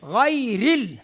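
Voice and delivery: غیر ال with a gliding ay; soft yaa. Shown as a demonstration. Teacher modelling soft yaa, gliding غیر ال. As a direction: stretch the ay of غیر ال smoothly. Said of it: "یہ یا لین ہے".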